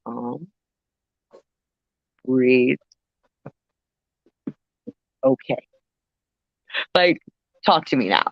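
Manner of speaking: low, soothing, unhurried gentle voice; calm a nervous fan down, slowly; sweet Clint Eastwood. Retold or imitated. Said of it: "He tells me so sweetly".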